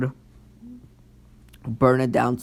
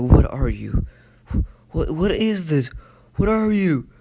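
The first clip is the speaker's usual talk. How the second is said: frightened crowd; 5 semitones higher, 4 dB louder than usual. Retold or imitated. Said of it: "And everybody's like".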